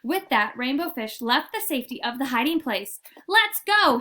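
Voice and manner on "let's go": high, loud rallying call; rally the others to follow. Acted. Rainbow Fish rallying friends out of hiding, loud. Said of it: "he called".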